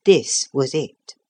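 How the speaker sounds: ordinary delivery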